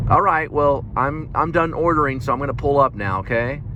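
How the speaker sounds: high polite voice. Retold or imitated. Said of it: "you give her a thing".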